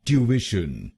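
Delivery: ट्यूशन back at normal speed, "tyoo-ish-un"; British pronunciation. Shown as a demonstration. Teacher finishing the British model at normal pace. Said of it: "तो बनेगा".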